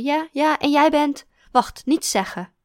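put-on the drunk old teacher thrilled to remember; tipsy old teacher. Kindergarten teacher voiced.

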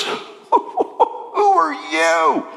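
very slow, shaken, half laughing question; stunned. Acted. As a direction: ask it dazed and trembling. Blinded Paul asks from the dirt.

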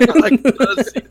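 high goofy cartoon voice; silly put-on voice. Joking about words on a podcast, goofy high voice. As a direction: play a dopey character bragging.

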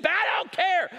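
high, loud mock shout; an exasperated customer yelling back. Acted quarrel over Coke price.

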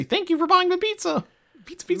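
high cartoon mascot voice; Little Caesar voice. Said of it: "Little Caesar should pop up and say".

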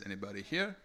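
usual speaking voice

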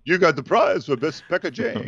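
high, put-on French accent, hammed up; mock a snooty French voice in a French accent; joking about a French award, a hammed-up French accent; bad French accent. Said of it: "Bad, bad accent".

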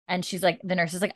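normal talking voice

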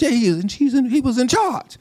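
retort. Real, not acted. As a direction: snap back high and amused. Preacher retorting to gossip.

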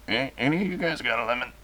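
quick, quiet put-on voice; dictator voice. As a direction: toss it off as the dictator. Ceausescu asks for lemon.

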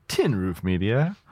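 Tin Roof Media with Tin punched at the front; stress on Tin. Shown as a demonstration. Host trying out emphases on a brand name, stress on Tin. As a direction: hit the first word, Tin, then let Roof Media follow. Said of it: "depends on what syllable you put the emphasis".

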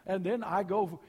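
high, loud, fast storytelling from the pulpit; lively preaching. Acted. Pastor amusing the congregation about doctor visits, loud and quick. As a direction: rattle off the doctor visits for laughs.

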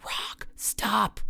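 high, breath-heavy gasp; urgent, half-voiced cry. A narrator's reading. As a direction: gasp out the command suddenly. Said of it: "suddenly gasped behind me".

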